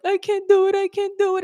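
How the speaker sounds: high, tearful wail imitating a student; mock crying. Student panicking in speaking practice, mock crying. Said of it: "she started crying".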